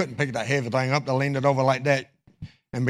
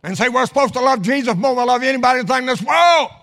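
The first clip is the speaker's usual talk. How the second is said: high, loud pulpit exhortation; raised, urgent sermon voice; impassioned preaching; 8 semitones higher, 7 dB louder than usual. Real, not acted. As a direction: drive the point home like a fired-up preacher. Preaches at full force.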